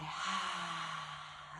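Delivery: long breathy exhaled sigh; big sigh. Shown as a demonstration. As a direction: let out a big, airy sigh. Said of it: "Let's let out a big sigh".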